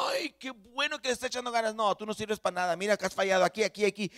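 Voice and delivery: sarcastic accuser, hammed up; devil's mock praise, then scolding. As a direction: gush in mockery, then pick at every failure. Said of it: "el diablo nunca te va a hablar".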